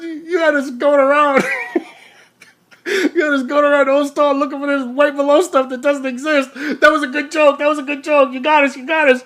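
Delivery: high, loud, laughing through the words; loud laughing tease. Retold or imitated. Passenger back from the store ribbing the driver, loud laughing. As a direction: crow over the joke between laughs. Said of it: "He goes".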